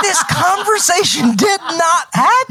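very high, slow shouted denial; exasperated denial. Real, not acted. Host denying the talk took place, exasperated high shout. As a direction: shriek it out word by word. Heated dispute over earlier conversation.

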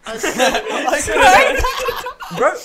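high, loud laughter breaking the words; laughing. Real, not acted. Telling friends of the neck snap, laughing hard. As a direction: laugh through telling the shock. Retelling shocked head turn.

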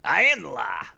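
lightly voiced gruff lawman; a hint of a stern cop; Judge Dredd voice. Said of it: "hang on let me think".

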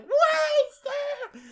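very high, drawn-out cry; mock alarmed shout. Woman warning a man about a car, mock alarmed shout. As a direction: call out a hammed-up warning. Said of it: "Anne Hathaway's like".

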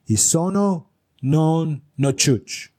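feed it to them slowly, one word at a time; slow coaching. Retold or imitated. Frank coaches Greg in mock Italian, slow and deliberate.

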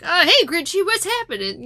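high, chummy mock greeting; playful sing-out hello; friendly townsfolk greeting. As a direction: hail an old pal in a high voice. Voicing townsfolk greeting the Grinch.

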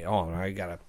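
while sipping a drink. Real, not acted. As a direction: mouth at the glass. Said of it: "Let me take a sip of water".

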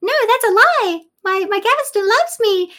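high-pitched, loud protest; high, insistent mock outrage; indignant queen. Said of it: "she goes".

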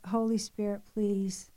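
low, soft pleading prayer; prayer under the breath. Retold or imitated. Drained mother praying as she sets the table, under her breath. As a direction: pray it privately, barely voiced up. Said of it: "praying under my breath in the Spirit".

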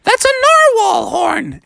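squeaky, very high sea-creature voice; friendly magical creatures in high falsetto; narwhal chorus. Acted. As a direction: reassure him in a tiny falsetto. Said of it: "And they all said".

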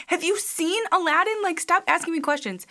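high, loud, exasperated retort. Retold or imitated. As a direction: snap back, fed up but laughing. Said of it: "and I'm like".

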